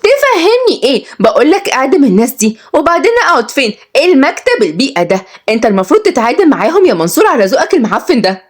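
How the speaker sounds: high, indignant complaining; angry heiress. A narrator's reading. Heiress berating her lawyer and his office, angry. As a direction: scold him in a high voice.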